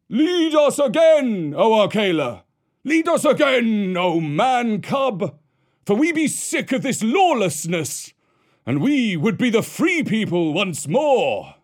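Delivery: high, howling plea; howling wolf. Acted. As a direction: howl it like a ragged wolf. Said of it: "one tattered wolf howled".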